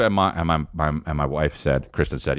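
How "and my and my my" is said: normal talking voice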